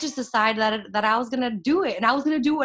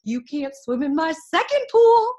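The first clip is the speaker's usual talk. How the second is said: louder, higher gloating to the doubters; gloating taunt; 6 semitones higher, 6 dB louder than usual. Retold or imitated. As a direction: rub it in their faces. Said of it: "I'm like".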